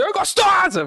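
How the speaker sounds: high, sudden mock catcall; catcaller's shout. Man in a passing car yells at a woman, mock catcall shout. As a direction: holler it like a man out a car window. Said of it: "bota a cabeça e fala assim".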